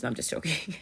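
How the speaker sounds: whispered "just joking" aside. Real, not acted. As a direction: hush it as a playful take-back. Retracts a naughty joke.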